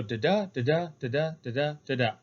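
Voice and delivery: "da da" with the second DA stressed; iambic rising meter. Shown as a demonstration. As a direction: tap out "da da" pairs, weak then strong. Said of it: "it starts with an unstressed".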